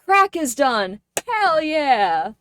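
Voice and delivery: loud, high triumphant whoop; excited cheer. Real, not acted. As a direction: cheer it like a little victory. Celebrating to chat.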